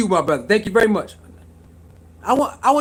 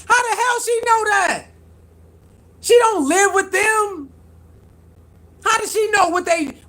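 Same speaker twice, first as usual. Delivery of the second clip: indignant; 8 semitones higher than usual. Real, not acted. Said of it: "indignant outburst".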